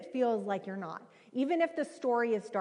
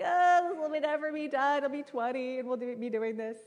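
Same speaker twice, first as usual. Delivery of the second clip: high, whiny mock despair; self-pitying cry from the bathtub; despairing wail; 5 semitones higher than usual. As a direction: wail it like it'll never end.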